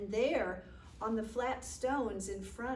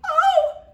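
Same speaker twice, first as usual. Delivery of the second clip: very loud, high "oh" cry; frightened cry; 20 dB louder than usual. A narrator's reading. Villagers crying out at the huge snake, frightened cry. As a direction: burst out in an acted yelp. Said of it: "And they cried".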